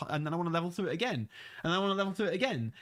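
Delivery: fast, eager repeat of the wish; rushed repetition. Retold or imitated. Player who loves replaying content, fast and eager. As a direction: rattle it off like an endless grind. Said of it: "I wanna level through this".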